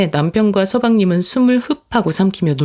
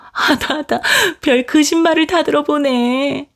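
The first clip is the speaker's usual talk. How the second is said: much higher, sing-song mocking voice; mocking sister-in-law; 8 semitones higher than usual. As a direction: taunt her with fake pity, pitched way up. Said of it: "사람을 골리는". (훨씬 높은 목소리로 비웃으며 놀리는 동서)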